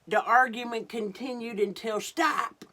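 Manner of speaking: big climax, then shout stop; loud dramatic read. A narrator's reading. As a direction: belt it out. Reading the loud argument.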